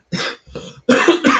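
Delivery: harsh smoker's cough; hacking smoker's cough; smoker's rasp. Real, not acted. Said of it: "Smoker's cough".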